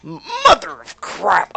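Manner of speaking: drawn-out, loud, high outburst; a loud, slow, high cry of dismay; loud exclamation. Real, not acted. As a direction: blurt it out slowly in dread. Arriving at parents' house.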